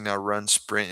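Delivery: usual speaking voice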